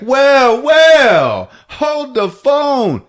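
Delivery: high, loud, drawn-out exclamation; hammed-up discovery, each word stretched; mock astonishment. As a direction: holler it in theatrical surprise. Character exclaims at a sighting.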